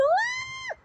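very high falsetto mock cry; imitated woman's yelp. Girl grabbed by the neck, high mock yelp. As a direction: squeal like a hurt woman, hammed up.

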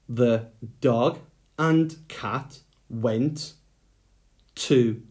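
slow, word by word dictation; slow dictation to young writers. Shown as a demonstration. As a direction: dictate each word slowly for writing. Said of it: "dictating sentence slowly".

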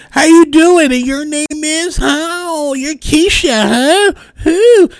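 high, mock-sweet greeting that turns sneering; fake-nice voice hiding a jab at the other woman; petty hi. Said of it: "They love to give a petty hi".

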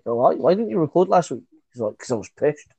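ordinary talk, nothing marked

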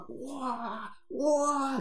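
high-pitched mock "wah"; high, drawn-out gasp of awe; awed audience. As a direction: imitate an easily wowed crowd. Imitating an impressed audience.

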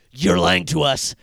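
through gritted teeth. Retold or imitated. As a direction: grind it out through clenched teeth. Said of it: "they all sound like they're talking through their teeth".

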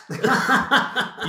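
high laughing burst; laughter. Real, not acted. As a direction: crack up mid-quote. Laughing through the quote.

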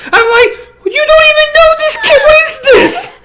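high, loud, laughing incredulity; laughing disbelief. Real, not acted. Friend reacting to the odd woman, laughing disbelief. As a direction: crack up while protesting.

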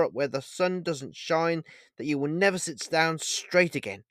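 high, heartbroken threat with murderous rage. Acted. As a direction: threaten him through heartbreak. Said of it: "heartbroken tone of voice, tinged with an almost murderous rage".

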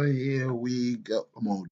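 ordinary talk, nothing marked

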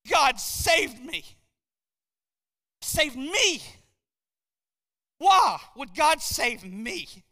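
loud, incredulous testimony cry; impassioned preaching. Real, not acted. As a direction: preach it hard, hammering key words. Impassioned testimony at full force.

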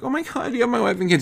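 very high, squeaky alarm; hammed-up horrified gasping reply; mock panic. Person answering the supposed kidnapper's call, mock panicked.

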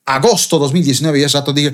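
ordinary talk, nothing marked

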